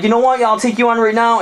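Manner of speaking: high, cocky jock challenge; challenging bro. Acted. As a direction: square up in a high voice. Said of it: "he was like".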